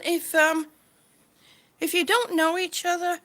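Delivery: ordinary talk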